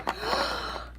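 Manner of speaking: high mock gasp of shock; freaked-out gasp. Little kid sees his drink pushed over, freaked-out gasp. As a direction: imitate a kid's horrified gasp. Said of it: "he freaks out over his drink".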